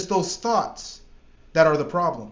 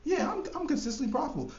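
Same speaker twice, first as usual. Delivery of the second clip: much higher, soft and quick, unconvinced; mock half-hearted claim; 10 semitones higher, 8 dB quieter than usual. A trader faking belief in himself, high and hollow. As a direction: toss it off like you don't mean it. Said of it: "not just think that you believe it and say".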